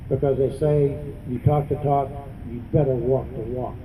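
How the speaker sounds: usual speaking voice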